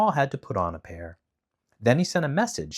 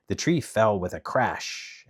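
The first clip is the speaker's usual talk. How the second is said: ordinary delivery, nothing marked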